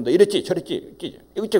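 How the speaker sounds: imitation of an accusatory grilling; accusing parent. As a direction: fire off accusations as an imitated parent who has already decided. Acting out parent jumping to conclusions.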